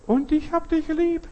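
very high, slow put-on voice; imitated fake voice. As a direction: squeak it out like a faker copying a voice. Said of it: "Stimmen nachmachen, imitieren". (gefälschte hohe Stimme, langsam)

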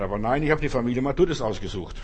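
ordinary talk, nothing marked

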